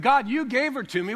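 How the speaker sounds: high, loud accusing cry; mock outraged Adam shouting; indignant Adam. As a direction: hurl the blame at God.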